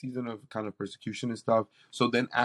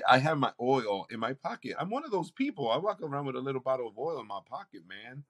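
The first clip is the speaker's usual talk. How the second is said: normal talking voice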